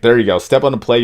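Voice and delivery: usual speaking voice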